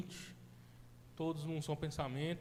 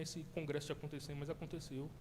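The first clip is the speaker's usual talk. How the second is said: ordinary talk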